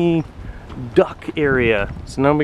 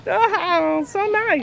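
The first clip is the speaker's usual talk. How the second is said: high cooing; much slower than usual. Real, not acted. Child petting a skunk.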